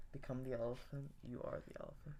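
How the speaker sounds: breathy whisper urging; whispered hype. Real, not acted. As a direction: whisper to get him in the zone. Hyping friend before noise.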